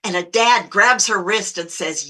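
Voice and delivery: ordinary delivery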